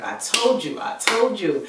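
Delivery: loud, insistent imitation of a sister; emphatic told-you-so. Sister hearing about the betrayal, vindicated told-you-so.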